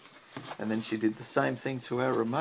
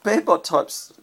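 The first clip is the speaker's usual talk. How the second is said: usual speaking voice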